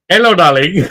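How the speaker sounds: high, loud sing-song hello; shopkeeper's greeting. Retold or imitated. Shopkeeper greeting him back, high and loud. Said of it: "he went to me".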